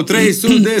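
high-pitched imitated fan chant; mock crowd yell. Fans in the park yell the band's name, mock yell. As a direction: mimic screaming fans without full volume. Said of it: "Și aia țipau".

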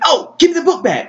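high-pitched, drawn-out eager call; mock an excited request, voice jumping up; acted-out burst of enthusiasm; eager exclamation. Hero eagerly asks for book back.

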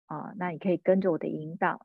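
ordinary talk

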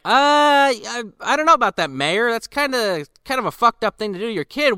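high, hesitant mock sheriff. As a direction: squeak out a reluctant refusal. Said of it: "the sheriff's just like".

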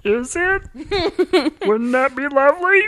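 very high cartoon alien voice; gleeful little Martian; Marvin the Martian. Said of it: "Marvin the Martian impression".

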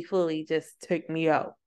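usual speaking voice

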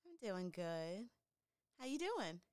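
faint, far from the mic; quiet voice too far from the mic; barely audible. Real, not acted. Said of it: "I can barely hear you".

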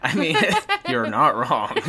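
laughing through the words; giggling while admitting it. Real, not acted. Conceding amid shared laughter.

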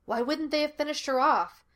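pointed, incredulous demand. A narrator's reading. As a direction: demand an answer, voice lifted. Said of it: "Gus demanded".

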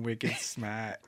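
understated Boston "smaht", R dropped; mock Boston accent. Joking about a dating bio, understated Boston accent. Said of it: "Spelled just like that".

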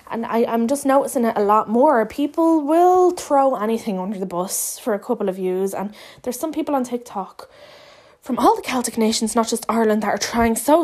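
normal talking voice

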